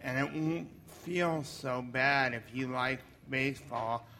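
ordinary talk

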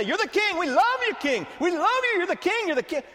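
high-pitched chanting fans; adoring crowd. Acted. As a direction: cheer like a crowd hailing its king. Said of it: "Everybody say".

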